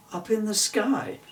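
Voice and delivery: usual speaking voice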